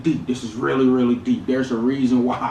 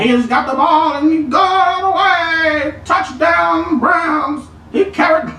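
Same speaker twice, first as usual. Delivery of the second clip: hammed-up old-time radio play-by-play, much higher and sing-song; old-school sports announcer; 14 semitones higher, slower than usual. Vlogger mocking old-school commentators with a hammed-up announcer voice. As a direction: ham it up like a vintage broadcaster calling a touchdown. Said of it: "imagine if we were talking like this".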